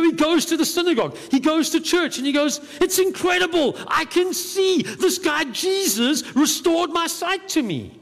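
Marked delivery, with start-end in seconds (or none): 2.8-7.9 much higher, thrilled voice of the healed man; excited. Retold or imitated. Healed blind man tells the synagogue he can see, much higher and thrilled. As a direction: burst out with the joy of seeing. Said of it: "obviously quite excited about the state".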